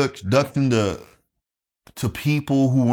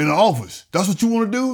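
higher, challenging finish; disdainful uncle; 9 semitones higher than usual. Retold or imitated. Uncle daring nephew to want an office job, disdainful. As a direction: imitate an uncle's incredulous challenge. Uncle belittles Air Force.

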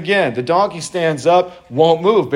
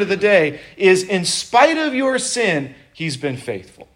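higher, slow, pleading appeal; impassioned sermon plea; preaching; 7 semitones higher than usual. Acted. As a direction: press the congregation to see it.

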